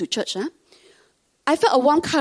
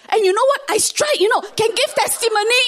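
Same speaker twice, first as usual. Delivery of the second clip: high, louder boastful delight; gleeful bragging; 11 semitones higher, 5 dB louder than usual. Retold or imitated. Mom boasts to church members about her lottery win, high and gleeful. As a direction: crow about the win like a proud gambler.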